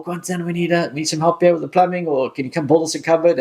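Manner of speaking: imitating the family asking Quinton to come fix things; calling on Quinton.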